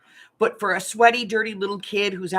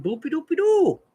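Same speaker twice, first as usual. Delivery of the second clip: put-on high silly singsong for a baby; silly baby-talk voice; 10 semitones higher, 4 dB louder than usual. Shown as a demonstration. Mother demonstrating the baby trick in a silly high voice. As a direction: coo it up high to tickle the baby. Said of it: "with a silly little voice".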